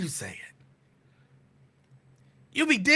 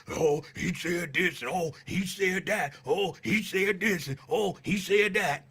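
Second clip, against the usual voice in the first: mock gossip; 4 semitones lower, 5 dB quieter than usual. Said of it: "a bitch behind my back".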